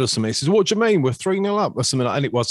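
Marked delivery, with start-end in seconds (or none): 0.5-1.7 hammed-up southern English accent, pitched much higher and louder; mock a southern English fan in a high, loud southern accent. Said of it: "that was a way more southern accent".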